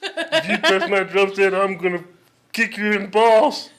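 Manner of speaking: loud nasal cartoon threat, laughing through it; goofy cartoon dad threatening; loud hammy Cleveland Brown bit. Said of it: "kinda sounds just like Cleveland Brown".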